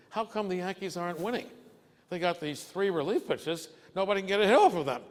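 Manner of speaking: hint of a worked-up kid; lightly voiced grandson. Grandson's excited complaint quoted.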